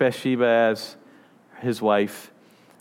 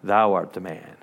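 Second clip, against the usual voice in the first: solemn prophet; 7 semitones lower than usual. Retold or imitated. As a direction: lay the verdict down low and heavy. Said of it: "He says".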